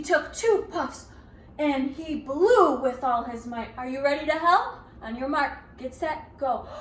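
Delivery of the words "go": plain reading voice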